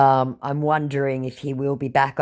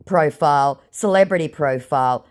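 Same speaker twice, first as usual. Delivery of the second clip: usual speaking voice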